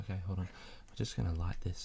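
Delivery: hushed and close to the mic; quiet, intimate murmur; soft ASMR voice. Acted. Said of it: "bit of A S M R there".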